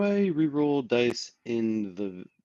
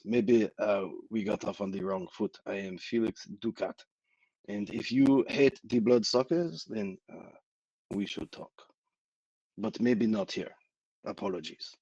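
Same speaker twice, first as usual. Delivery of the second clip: bad French accent. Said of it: "in a very bad French accent".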